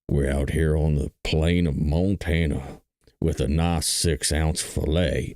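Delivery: low, slow ad-man impression; Outback commercial voice. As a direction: drawl it deep like a steakhouse ad. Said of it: "remember that Outback Steakhouse commercial".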